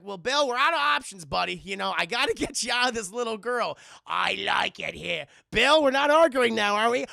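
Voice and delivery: loud, rising irritation at a ghost; mock angry. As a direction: exorcist losing patience.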